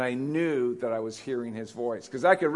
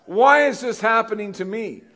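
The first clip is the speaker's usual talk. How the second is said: loud, high-pitched mock wail to God; indignant complaint; 6 semitones higher, 8 dB louder than usual. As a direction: cry out in hammed-up protest.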